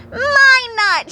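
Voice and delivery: high, loud claim; triumphant shout. Acted. Pecan racing Hickory, shouting triumphantly. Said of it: "shouted Pecan triumphantly".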